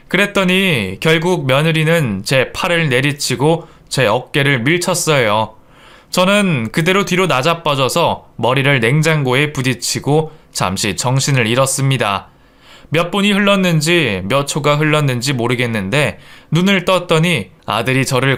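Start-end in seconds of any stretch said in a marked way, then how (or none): none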